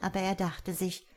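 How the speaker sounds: ordinary delivery, nothing marked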